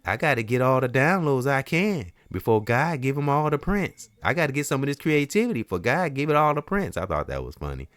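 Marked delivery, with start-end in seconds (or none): 0.1-6.9 hammed-up high voice of a pop star. Vlogger retelling a movie scene, high-voiced impression. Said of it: "And he said".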